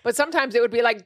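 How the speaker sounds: normal talking voice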